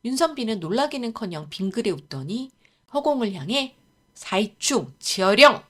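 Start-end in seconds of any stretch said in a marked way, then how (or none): 4.1-5.6 slow, loud spell-casting cry; shouted incantation. A narrator's reading. Scholar summoning a rat into the air, shouted incantation. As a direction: call out a spell, drawing it out. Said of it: "이라고 외치고는".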